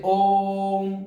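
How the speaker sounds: ओम with the ओ held long, well past two beats; prolonged pluta vowel. Shown as a demonstration. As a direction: stretch the ओ of ओम slowly like a chant. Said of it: "दो मात्रा से अधिक समय लगता है". (ओम का ओ बहुत देर तक खींचकर)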